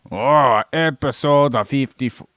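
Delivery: loud, theatrical opener; belted episode announcement. Acted. Host repeats the episode announcement, loud and theatrical. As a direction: call out the episode with gusto.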